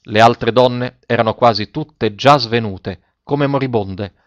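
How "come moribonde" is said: ordinary delivery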